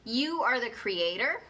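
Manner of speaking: ordinary talk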